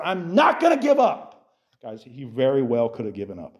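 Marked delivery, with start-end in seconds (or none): 0.0-1.6 determined vow. Retold or imitated. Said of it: "He said".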